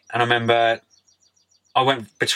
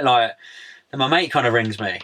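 usual speaking voice

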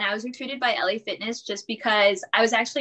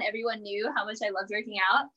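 ordinary talk, nothing marked

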